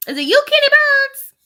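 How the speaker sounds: squeaky mock voice. Teasing guess at mystery buyer.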